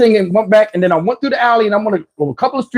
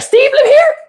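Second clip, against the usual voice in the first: much higher, questioning; high mock woman voice; 3 dB louder than usual. Woman at the door asking for Steve, high mock voice. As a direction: imitate her at the door. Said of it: "She like".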